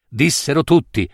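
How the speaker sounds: usual speaking voice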